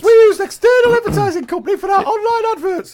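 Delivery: high squeaky quoted voice. Retold or imitated. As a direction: squeak it out like he's on helium. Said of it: "why does he speak in such a high voice".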